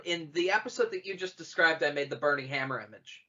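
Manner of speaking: ordinary delivery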